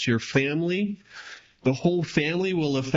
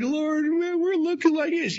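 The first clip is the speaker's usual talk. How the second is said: much higher, mock whiny complaint; whining Joshua; 11 semitones higher than usual. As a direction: whine it up high like a complaining child. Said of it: "Joshua's like".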